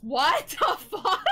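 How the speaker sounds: ordinary delivery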